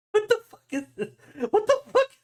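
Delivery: high laughing what the fuck; shocked laugh at a raunchy picture; laughing disbelief. Real, not acted. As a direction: blurt it out through laughter. Shocked by the cover image.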